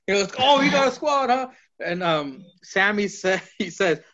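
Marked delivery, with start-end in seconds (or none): 0.4-1.5 mocking echo. Retold mocking echo from Sammy.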